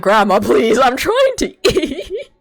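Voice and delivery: high, loud laughing plea, slowed on the last words. Retold or imitated. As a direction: beg her to stop while cracking up. Begging grandma at the table.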